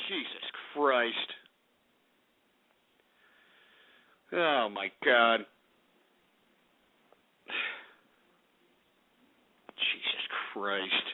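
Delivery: low, slow, drained murmur; dejected muttering. Real, not acted. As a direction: sigh it out softly, utterly defeated. Dejected after saying he is depressed.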